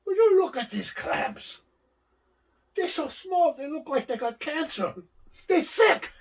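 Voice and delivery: high, slow, aggrieved complaint; outraged Fuji. Retold or imitated. Fuji to the manager, high slow outraged complaint. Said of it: "He goes".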